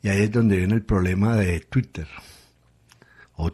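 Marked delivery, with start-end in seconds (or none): none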